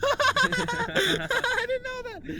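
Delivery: words stretched out through high laughter; laughing. Real, not acted. Laughing at his friend's piggy bank joke. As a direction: laugh it out slowly.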